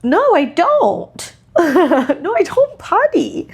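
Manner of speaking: put-on high, slow whiny toddler voice; mock toddler saying no, laughing; toddler refusing. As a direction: whine it like a stubborn little boy. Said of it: "And he's like".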